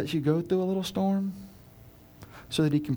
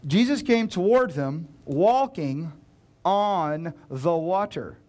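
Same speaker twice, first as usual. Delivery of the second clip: slow, deliberate, every word weighed; slow dawning reread; slowed for effect; slower than usual. Shown as a demonstration. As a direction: let the words land one by one.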